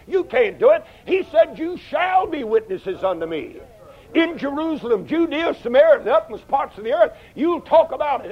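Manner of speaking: high, ringing revival preaching; fiery pulpit cadence; preaching at full force. Acted. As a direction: preach it out, climbing and climbing.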